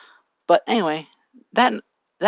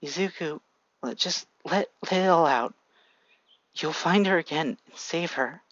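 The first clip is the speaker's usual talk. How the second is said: comforting mother; 3 semitones higher than usual. A narrator's reading. Mother hugging her crying son, tender. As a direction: hold him and soothe him. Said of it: "hugs him".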